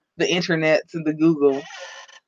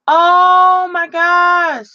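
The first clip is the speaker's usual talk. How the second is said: long, drawn-out embarrassed oh my gosh; high, slow, mortified exclamation; mortified gasp; 11 semitones higher, 6 dB louder, much slower than usual. Real, not acted. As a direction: stretch it out, caught off guard. Embarrassed, noticing the live comments.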